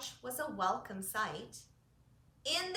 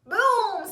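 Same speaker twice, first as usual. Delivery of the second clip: loud, higher boom; thunder boom; 9 semitones higher, 13 dB louder than usual. Acted. Goat booms to mock thunder, loud. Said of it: "said Goat".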